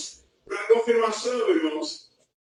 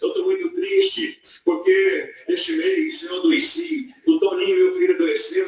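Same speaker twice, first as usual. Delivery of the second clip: mild tearful plea, higher and slow; lightly voiced old blind woman near tears; 8 semitones higher than usual. Blind old sister tells the preacher her troubles, mildly tearful. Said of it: "batia a bengala no chão e chorando".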